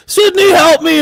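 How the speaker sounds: high pleading voice; mock victim plea. Mocking Ghostface impersonating Dewey, high plea. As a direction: squeak it out like a fake helpless call.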